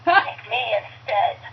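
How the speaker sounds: put-on raspy Boston girl's heroic plea; Jenny Miller. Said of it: "Jenny throws herself in between them and goes".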